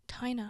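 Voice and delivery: soft whispered "Tina", TIE-na; whispered name. Shown as a demonstration. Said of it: "It's just Ty and Na".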